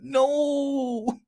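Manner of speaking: mock voice. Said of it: "we got".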